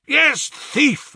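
very high, furious shout; roared. A narrator's reading. Father shaking his son by the shoulder, furious roar. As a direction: roar the accusation at him. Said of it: "I roared".

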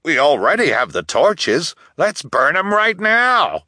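high, loud elder rousing the crowd; eager, angry call to act; mob rallying cry. A narrator's reading.

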